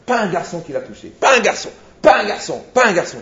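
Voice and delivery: high, loud, falling bursts; emphatic insistence. Retold or imitated. Young man vouching for his bride to the rabbi, acted insistence. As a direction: hammer each repeat like an acted boast.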